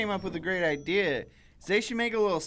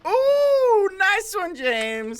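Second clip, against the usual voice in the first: mock praise; 8 dB louder, much slower than usual. Real, not acted. Teasing praise.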